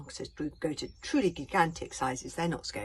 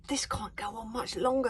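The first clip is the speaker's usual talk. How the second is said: louder urgent worry, stress on longer; anxious thought; 5 semitones higher, 4 dB louder than usual. A narrator's reading. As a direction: push it out with mounting urgency. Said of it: "thought Hiccup".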